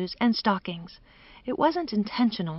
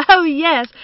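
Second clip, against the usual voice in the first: high, loud, merry exclamation; amused agreement; 7 semitones higher, 8 dB louder than usual. A narrator's reading. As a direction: chime in loudly, joining the merriment. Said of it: "joined in the laughter".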